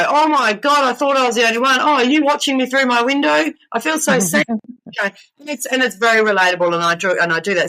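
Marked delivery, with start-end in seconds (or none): 0.1-4.4 gushing follower. Retold or imitated. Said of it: "people that go".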